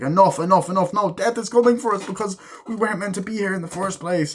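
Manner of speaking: high-pitched, frantic mock outburst; acted agitated argument. Acts an agitated family argument.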